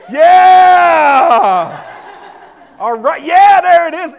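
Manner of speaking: big loud cheering yell; excited cheer. Real, not acted. Kids stand up, cheering.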